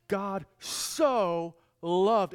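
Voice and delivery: slow, heavy stress on «so»; emphatic. Shown as a demonstration. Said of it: "in the Greek it's emphatic".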